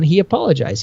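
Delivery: usual speaking voice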